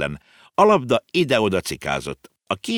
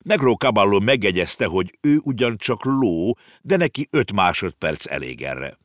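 usual speaking voice

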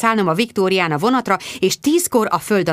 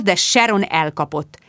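usual speaking voice

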